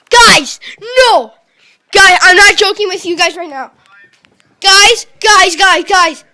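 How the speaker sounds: loud, high frantic yells; can't believe the pull, shouting; excited yelling. Real, not acted. Kid shouts at his viewers over a huge pack.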